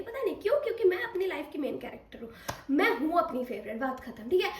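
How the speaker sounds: ordinary talk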